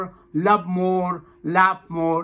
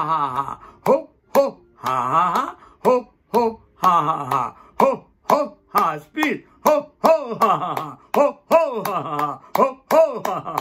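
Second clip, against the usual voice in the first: rhythmic laughter exercise. Shown as a demonstration. Yoga teacher leads a clapping warm-up, rhythmic forced laughter. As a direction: chant the laugh in time, building faster. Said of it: "clapping like this".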